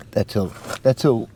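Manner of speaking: normal talking voice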